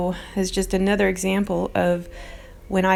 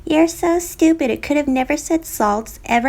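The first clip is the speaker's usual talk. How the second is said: mocking troll commenter; 7 semitones higher, 3 dB louder than usual. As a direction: mimic the snotty commenter, pitched way up. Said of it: "I was getting comments like this".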